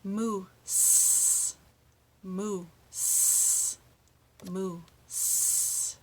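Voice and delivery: MOOSE with the S set apart and held long; slow drill, stretch the end of each moose; long final S. Shown as a demonstration. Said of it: "Let's try that three times".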